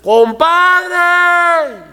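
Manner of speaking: high, loud long-drawn call; long shout. Shown as a demonstration. Man calling to his friend far away, high drawn-out yell. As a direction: call across a long distance at full voice. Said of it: "Esa es una voz alta y esforzada".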